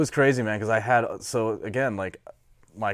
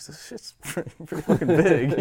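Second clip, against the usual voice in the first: laughing disbelief; 6 semitones higher than usual. Retold or imitated. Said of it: "I was just like".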